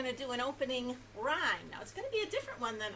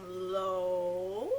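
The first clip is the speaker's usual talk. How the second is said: drawn out; 5 semitones lower, 6 dB louder than usual. Shown as a demonstration. Voice acts out stretching low.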